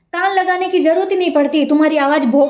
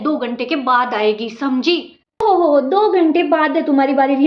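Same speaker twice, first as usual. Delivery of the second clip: ordinary delivery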